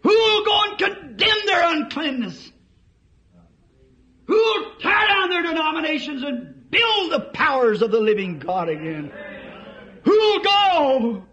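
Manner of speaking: high preaching shout; voice of God. Acted. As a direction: thunder the challenge at the congregation. Voice of God calling out. (God's voice demanding who will go)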